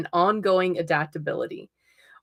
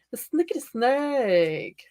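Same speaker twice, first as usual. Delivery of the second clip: lightly voiced, higher imitation of fans; mock millennial quote; 5 semitones higher than usual. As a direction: imitate the viewers with a grin. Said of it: "every one of you are going".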